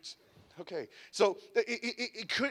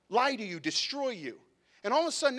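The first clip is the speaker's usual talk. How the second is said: ordinary delivery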